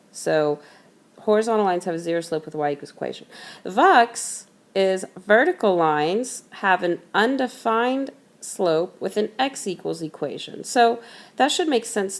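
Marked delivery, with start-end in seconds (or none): 1.3-3.3 rattled-off rule, very fast; quick recap. Shown as a demonstration. 3.6-10.6 slow, spaced-out rule for note-taking; slow dictation. Shown as a demonstration. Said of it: "I'll say this slowly".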